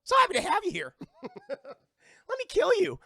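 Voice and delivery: high, loud goofy killer-chair voice; gleefully hammed-up high character voice; Cherry's voice. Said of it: "that's his voice".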